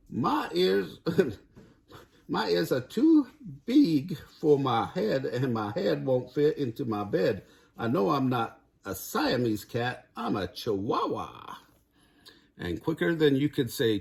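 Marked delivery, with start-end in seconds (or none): 0.1-11.6 hammed-up Spanish accent, high at first; playful mock Spanish accent. The kitty boy talks to the mirror in a mock Spanish accent. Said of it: "using his very best Spanish accent".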